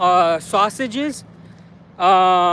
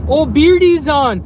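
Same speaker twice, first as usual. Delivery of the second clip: excited greeting; 6 semitones higher, 5 dB louder, slower than usual. Real, not acted. Greets viewer Beardy live.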